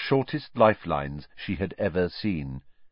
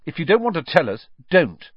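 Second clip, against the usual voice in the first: quick, loud, higher-pitched retort; snapping; 9 semitones higher, 6 dB louder than usual. A narrator's reading. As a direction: snap it, landing hard on the last word. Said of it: "snapped Ron".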